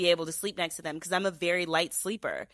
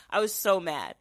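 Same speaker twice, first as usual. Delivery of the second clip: normal talking voice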